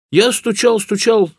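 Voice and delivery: high, insistent character voice; naive peasant. A narrator's reading. Hunter tells the professor he kept knocking, naive peasant. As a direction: stress your effort with a high voice.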